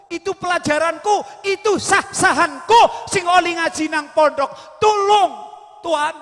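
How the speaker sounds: high, pleading voice begging the robber; whining plea. Acted. Ghazali begs the robber to return his books, pleading. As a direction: beg him like a desperate student. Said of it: "Imam Al-Ghazali merengek".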